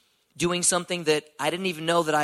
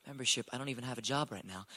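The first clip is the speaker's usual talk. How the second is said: much softer, under the breath; muttered aside; 2 semitones lower, 11 dB quieter, faster than usual. Retold or imitated. Job seeker to himself after the owner's membership offer, muttered aside. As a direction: drop it to a quiet private grumble.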